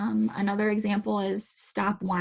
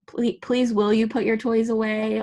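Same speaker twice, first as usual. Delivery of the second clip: pleading request; 3 semitones higher, 5 dB louder than usual. Acted. Parent asking children to tidy toys, mildly pleading. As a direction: plead a little, raising pitch and volume. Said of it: "If we ask children to".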